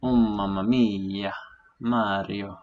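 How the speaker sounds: slow hammy Italian exclamation; mock Italian chef groan; Italian accent. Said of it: "gets you speaking Italian".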